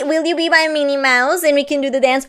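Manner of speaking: normal talking voice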